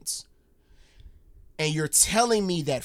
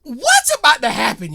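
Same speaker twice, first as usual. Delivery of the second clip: much higher, louder excited question; 11 semitones higher, 13 dB louder than usual. Real, not acted. Host to storyteller, bursting with excitement. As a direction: burst out in eager anticipation.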